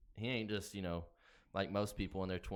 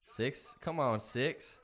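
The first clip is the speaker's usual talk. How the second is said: urging on; 5 semitones higher, 5 dB louder, much slower than usual. Real, not acted. Horse losing.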